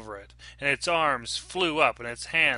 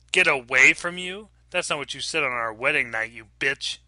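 loud mocking outrage; drunken sneer; 5 dB louder than usual. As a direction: throw her words back, nasty. Drunk husband mocks wife.

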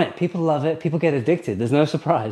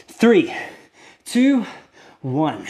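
straining with effort; much slower than usual. Real, not acted. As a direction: squeeze out each number under strain. Counting down holding plank.

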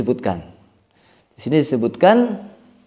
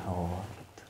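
whispered aside; 9 semitones lower, 22 dB quieter, slower than usual. Acted.

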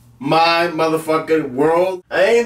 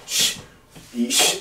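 hushing; 8 semitones lower, 7 dB quieter than usual. Acted. Hushing the viewer.

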